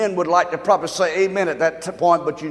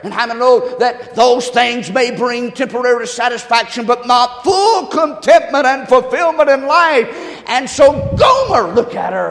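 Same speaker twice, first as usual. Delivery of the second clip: louder, higher driving preacher cadence; full-force preaching; 5 semitones higher, 8 dB louder than usual. Acted. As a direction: preach it hard to the congregation.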